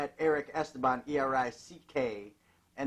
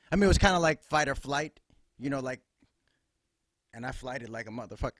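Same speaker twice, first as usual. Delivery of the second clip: normal talking voice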